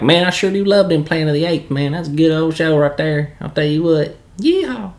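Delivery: put-on thick Southern accent. Playing up his Southern accent for a fan who loves it. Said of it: "give them a little more of my Southern accent".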